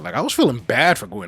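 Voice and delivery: ordinary talk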